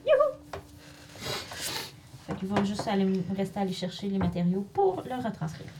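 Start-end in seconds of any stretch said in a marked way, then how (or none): 0.1-0.6 excited cheer. Real, not acted. Player cheers at the gift.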